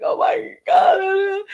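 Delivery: high, slow crying wail; sobbing cry of oh my God. Retold or imitated. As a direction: wail it through tears. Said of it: "That kind of cry".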